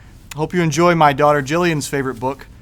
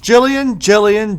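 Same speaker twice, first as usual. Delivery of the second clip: high sing-song call of a name, a bit louder; mother calling out; 6 semitones higher, 6 dB louder, slower than usual. Acted. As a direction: call her child from down the hall. Mother calls daughter third time.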